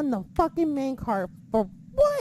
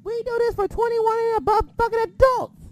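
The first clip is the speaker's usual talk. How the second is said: angry rant; 6 semitones higher, 6 dB louder than usual. Real, not acted. Ranting at a critic.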